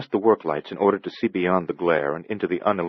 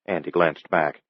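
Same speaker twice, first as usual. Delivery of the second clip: usual speaking voice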